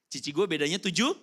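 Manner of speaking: ordinary talk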